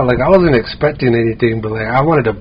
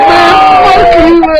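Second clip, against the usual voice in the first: indignant shouted retort; 6 dB louder than usual. Retold or imitated. Concertgoer retelling his comeback to a heckler, indignant shout. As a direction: fire back at a heckler, voice way up.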